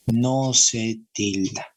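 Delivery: "No se tilda" slowly, each word weighted; slow emphatic repeat. Shown as a demonstration. Teacher driving the rule home to students, slow and emphatic "No se tilda". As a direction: spell out "No se tilda" at half speed.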